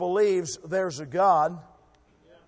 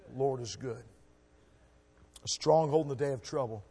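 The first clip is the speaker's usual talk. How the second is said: ordinary delivery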